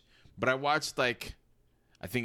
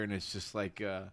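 talking through a burp; words pushed out round a burp; 8 dB quieter than usual. Real, not acted. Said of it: "sorry, burp".

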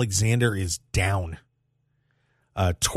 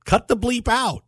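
high, slow mock stern order from the bosses; mock corporate order; 13 semitones higher, 3 dB louder than usual. Host mocking the network's order to cut swearing, high and slow. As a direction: ham it up like a network exec laying down the law. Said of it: "Time Warner Discovery has said".

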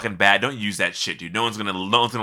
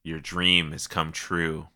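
lower mysterious offer voice; 6 semitones lower, 8 dB quieter than usual. Pretend. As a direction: deliver it like a secret deal. Grand mock offer to friend.

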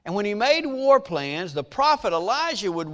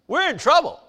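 loud, higher-pitched mock panic; crying out in alarm; 5 semitones higher, 7 dB louder than usual. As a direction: call out like an alarmed servant, hammed up. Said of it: "he cries out and says".

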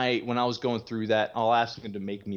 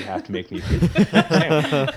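laughing through the mock demand, louder; laughing quote; 5 semitones higher, 9 dB louder than usual. Retelling a brother's sandwich demand, laughing and louder. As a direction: crack up while delivering the punchline.

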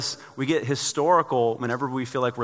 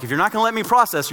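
mock warning; 7 semitones higher, 5 dB louder than usual. Processor person warning spouse.

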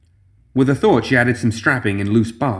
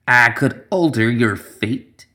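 put-on old witch voice; slower than usual.

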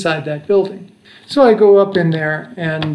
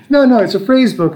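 higher, louder insistent denial; eager protest; 6 semitones higher, 5 dB louder than usual. Retold or imitated. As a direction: hurriedly set the officer straight. Insisting to the customs officer.

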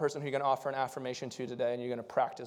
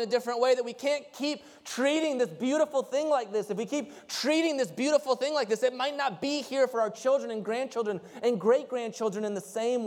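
raised, higher pulpit voice building to the point; full-force preaching; 9 semitones higher, 5 dB louder than usual. Acted. Pastor urging his congregation to see beauty, preaching at full force. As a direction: preach it out to the room, higher and louder.

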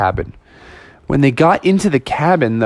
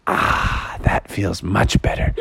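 soft, lower breathy relief; a touch of relieved sigh; 8 semitones lower, 5 dB quieter, slower than usual. Acted. Bubby after the cold rinse, soft relieved sigh. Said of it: "He's like".

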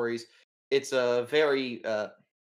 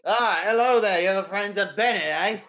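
louder, higher, jovial English editor voice; cigar-chomping editor; 7 semitones higher, 8 dB louder than usual. Acted. Newspaper editor greeting his visitors, loud jovial voice. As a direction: boom a hearty hello round the cigar. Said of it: "he's talking like this".

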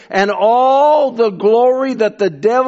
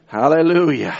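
normal talking voice

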